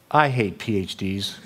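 low, slow deadpan imitation; grudging retort. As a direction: play a beaten opponent's sour comeback. Retold grudging punchline from the man.

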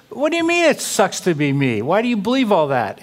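mock-indignant comeback; indignant challenge. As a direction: imitate an affronted, high-pitched retort. Retold challenge from offended man.

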